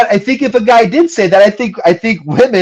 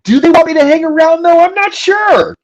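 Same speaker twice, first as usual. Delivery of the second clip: high-pitched, baffled protest; higher, exaggerated bewilderment; mock confusion; 9 semitones higher than usual. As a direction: act out a clueless guy, pitched way up. Acting out a confused man.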